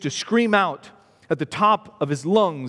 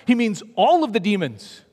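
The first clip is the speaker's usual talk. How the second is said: normal talking voice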